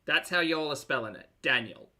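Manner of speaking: ordinary delivery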